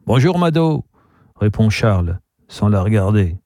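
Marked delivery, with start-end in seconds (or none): 0.1-0.8 higher-pitched hello in character; lightly lifted, called-out greeting; cheerful greeting. A narrator's reading.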